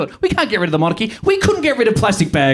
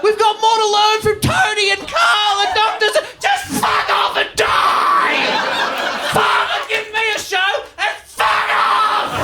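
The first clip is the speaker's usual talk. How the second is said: high, strained yelling, stretched out; comic meltdown at full pitch; exasperated screaming rant; 9 semitones higher, slower than usual. Acted. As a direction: build to a screamed, drawn-out curse. Comic rant boils over.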